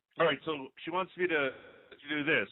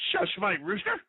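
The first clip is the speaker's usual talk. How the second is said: much higher, sing-song shush; hushing the rooster. Real, not acted. Hushing his rooster on air.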